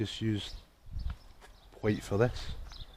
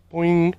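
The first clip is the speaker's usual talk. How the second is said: squeaky high boing; playful boing sound effect; 10 semitones higher, 6 dB louder than usual. Acted. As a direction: bounce the word out loud and high. Cat pounces.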